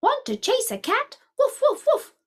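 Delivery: high-pitched dog character voice; barking dog. A narrator's reading. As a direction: talk high and bouncy like a dog, ending in woofs. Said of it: "barked the dog".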